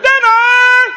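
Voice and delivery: high, loud call across the yard. Retold or imitated. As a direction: holler it to bring the kids home. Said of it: "only until we heard".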